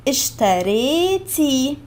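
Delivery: اشتريتي drawn out slowly, each syllable clear; slow model word. Shown as a demonstration. Teacher modelling اشتريتي slowly for learners, drawn out. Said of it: "اشتريتي, we removed the A".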